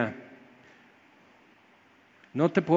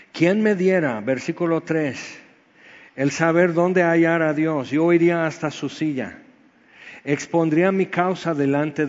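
louder preaching read of Job's lament; fervent scripture reading; 4 dB louder than usual. Acted. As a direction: proclaim the verses with full force. Reads Job aloud from scripture. (lectura bíblica enfática)

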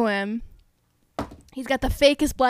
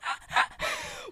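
high laugh; 9 dB quieter than usual. Real, not acted. Laughing at waiting four seasons.

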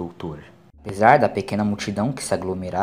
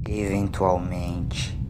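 Jamie echoing; 5 semitones lower, 5 dB quieter, much slower than usual. A narrator's reading. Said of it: "repetiu Jamie".

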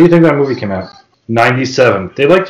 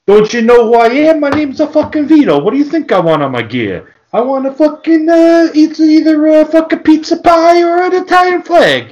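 mock meathead; 13 semitones higher than usual. Mocking wrestler Vito demanding his Italian-flag gear, high and hammed-up. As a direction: ham up a proud dim wrestler.

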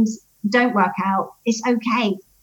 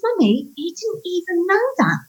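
put-on amazed child; 7 semitones higher than usual. Son voiced amazed.

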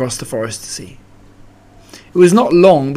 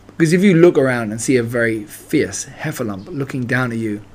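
usual speaking voice

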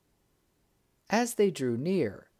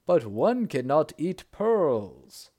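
slow and louder protest; drawn-out, louder indignant protest; crying out; 6 dB louder, slower than usual. A narrator's reading. Sultan protesting to the bird about the pearls, slow and louder. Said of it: "cried he".

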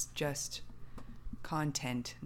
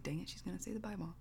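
softer, quick aside; retold inner thought; 2 semitones higher, 8 dB quieter, faster than usual. Friend recalling what she was thinking, quiet retold thought. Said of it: "I was like".

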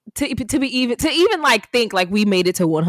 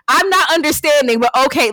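higher, louder, sassy and curt; retold attitude; 6 semitones higher, 5 dB louder than usual. Wife recalling her sassy reply to her busy husband. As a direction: give him some attitude. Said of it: "I got an attitude".

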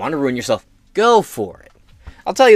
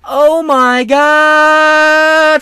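much higher, louder, drawn out; hammed-up panicked wail; 11 semitones higher, 8 dB louder, much slower than usual. Parent fearing the state takes her kids, wailing in mock panic. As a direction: ham up a panicking mother.

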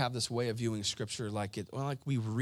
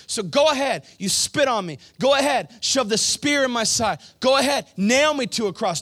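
high, loud, forceful shout; impassioned plea; 10 semitones higher, 10 dB louder than usual. Acted. Jesus tells his killers to go ahead, impassioned shouting. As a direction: cry out a desperate challenge at full force.

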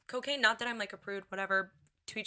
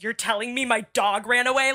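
mock outrage; 2 semitones higher, 9 dB louder than usual.

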